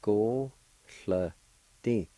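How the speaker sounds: Go, sl, ti, each sound on its own; syllable by syllable. Shown as a demonstration. Teacher showing third part of flaxseed, syllable by syllable. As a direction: sound out "Go, sl, ti" with pauses. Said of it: "Third part".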